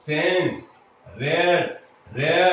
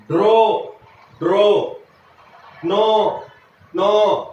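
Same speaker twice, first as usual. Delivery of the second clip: raised, emphatic word-by-word drill; reading out loud; 3 semitones higher, 4 dB louder, slower than usual. Shown as a demonstration. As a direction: call out each word separately for the class to repeat. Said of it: "મોટેથી બોલવાનું છે".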